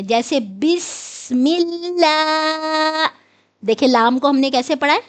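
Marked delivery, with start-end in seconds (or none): 0.4-3.1 بسم اللہ with a light, thin lam, drawn out slowly. Shown as a demonstration. Teacher reciting بسم اللہ to students, slow, with the thin lam. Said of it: "کو باریک پڑھتے ہیں".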